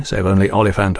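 ordinary delivery

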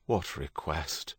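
soft, slow, wary question. A narrator's reading. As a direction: ask it low-key and drawn out, on guard. Stephen senses a sudden chill.

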